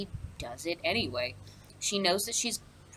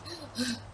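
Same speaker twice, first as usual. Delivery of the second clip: mock panting gasp and sigh; out of breath; 5 semitones higher, 8 dB quieter than usual. Guard runs in to the king, out of breath. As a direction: puff softly as if just run in. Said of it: "he's out of breath".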